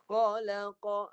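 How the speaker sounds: Khalaqa drawn out slowly, from deep in the throat. Shown as a demonstration. Teacher models correct khalaqa for the pilgrim. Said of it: "bunyi daripada dalam".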